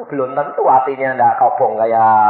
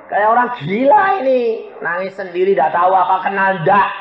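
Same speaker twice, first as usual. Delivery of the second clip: mock childlike whine, raised pitch; whining complaint; 6 semitones higher than usual. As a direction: complain like a kid nagging Dad. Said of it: "Saya bilang, ngerengek".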